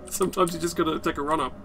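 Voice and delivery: normal talking voice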